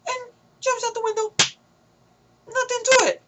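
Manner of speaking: very high put-on squeal of ridicule; mocking falsetto. Reviewer ridiculing an easy window escape, high mocking squeal. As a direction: squeak it in mock amazement.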